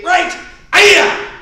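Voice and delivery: sharp high martial-arts yell; kiai shout. Shown as a demonstration. Instructor leading class drill, loud kiai shout. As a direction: shout the strike out from the gut.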